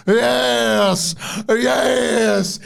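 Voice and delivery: high, loud eerie yes; Uncle Howdy. Retold or imitated. Cult leader urging a leap off the top rope, eerie and loud. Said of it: "just goes".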